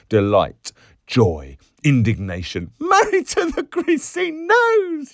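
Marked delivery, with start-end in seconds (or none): 2.8-5.1 high, loud hilarious screech; piercing mock outrage; shrill yell. A narrator's reading. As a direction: yell it out in delighted indignation. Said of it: "she yelled, shrill".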